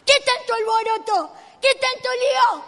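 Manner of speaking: high, shouted exasperated telling-off; loud scolding. Acted. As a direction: berate him for all the racket. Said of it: "Y otra vez lo retaban". (reto indignado a gritos)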